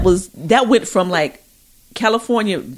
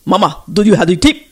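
put-on African accent; 4 dB louder than usual. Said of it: "Can you work on your African accent".

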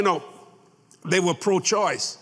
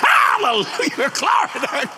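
praise shout; 5 semitones higher, slower than usual. Real, not acted. Preacher exclaims praise mid-sermon.

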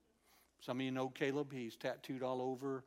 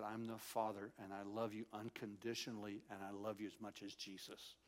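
softer, low voice of a loving Father; tender fatherly God; 4 semitones lower, 7 dB quieter than usual. Retold or imitated. God telling his child he is loved unconditionally, tender. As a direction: reassure gently, low and hushed, dropping away at the end. God's words of love retold.

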